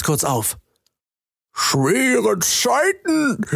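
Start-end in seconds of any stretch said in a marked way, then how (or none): 1.5-3.5 high, very slow frog creature voice; a sly frog drawing out his words. Acted. Frog creature greets Jamie across the pond, high slow drawl. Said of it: "erklingt eine Stimme".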